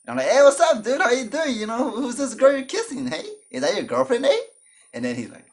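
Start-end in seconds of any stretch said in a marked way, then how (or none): 0.3-4.4 teasing hype. Retold or imitated. Teasing his cousin with the girl.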